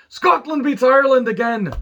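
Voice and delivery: loud, high, bad Scottish-style accent; hammed-up, high-pitched attempt at a Scottish accent; terrible accent. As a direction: crow it in a badly faked Scottish accent. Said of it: "That was a terrible accent".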